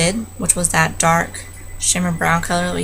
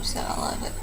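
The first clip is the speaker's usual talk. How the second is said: whisper; 10 dB quieter than usual. Real, not acted. Aside while applying mascara.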